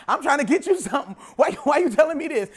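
ordinary talk

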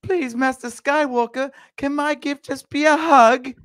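put-on very high, slow, sweet child voice; Robot Chicken kid. Parodying a sweet clone kid, tiny high voice. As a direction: squeak it like a cartoon child. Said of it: "thinking Robot Chicken with".

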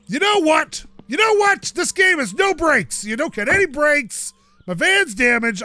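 indignant rant about the brakes; exasperated rant. Real, not acted. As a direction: vent at the game. Ranting about damaged van.

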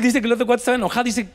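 ordinary talk

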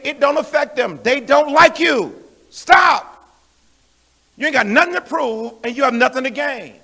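loud, high exhortation; full-force preaching. Real, not acted. Preacher urging the saints to stop retaliating, full-force preaching. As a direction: drive each command home at full volume.

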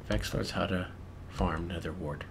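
ordinary talk

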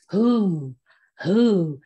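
low, loud, mysterious call; menacing underworld lord. Shown as a demonstration. Underground lord always looking up, menacing and mysterious voice. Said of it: "it has to be a little menacing".

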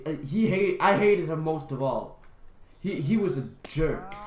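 normal talking voice

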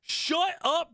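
high, yelled call to a friend. Real, not acted. Gamer yells at a friend over a risky chat message, calling out. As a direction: yell his name to shut him up.

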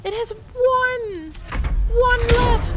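high, loud desperate yelling; frantic shouting. Real, not acted. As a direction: yell at the screen in frustration. Frantic shouting at failing companion.

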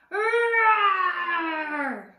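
long loud high roar; playful lion roar. Acted. Boy pretending to be a lion, playful roar.

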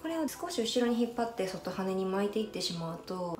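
normal talking voice

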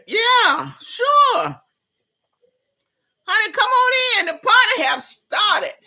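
high, loud welcoming hollers; excited host inviting the chat in; party hype. Real, not acted. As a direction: call everyone in to the party.

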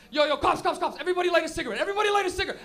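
loud, urgent, rising alarm; panicked orders. Acted. Smoker warns friends in the car about cops, acted panic. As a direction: fire off frantic repeated commands.

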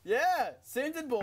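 triumphant cheer. Real, not acted. Player celebrating a centered ball, triumphant. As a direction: crow over the hit, stretching it out.

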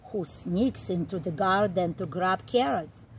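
ordinary delivery

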